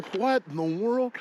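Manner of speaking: high, amazed disbelief; surprised. Real, not acted. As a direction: sound baffled and amazed. Reacting to skiers' plan.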